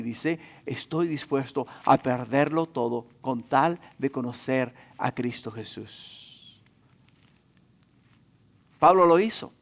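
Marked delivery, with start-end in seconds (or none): 0.7-6.6 hushed and low, fading away; soft, lowered preacher's solemnity; solemn declaration. Retold or imitated. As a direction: let it sink to a reverent near-whisper by the end. Solemn climax of Paul's declaration.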